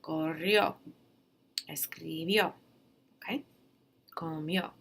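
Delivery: Corrió with the final O stressed, loud at the end; Corrió, Escribió, Comió with a strong final O. Shown as a demonstration. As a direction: punch the O in Corrió. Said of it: "hear that O nice and loud at the end".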